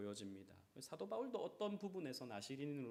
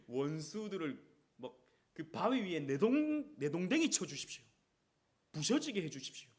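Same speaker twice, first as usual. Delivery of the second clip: mock vengeful prayer; 3 semitones higher, 8 dB louder than usual. Acting David's curse psalm.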